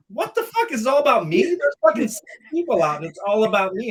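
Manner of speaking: high, loud indignant rant; angry outburst. Retold or imitated. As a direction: blow up at the instructor in disbelief. Retelling his angry outburst.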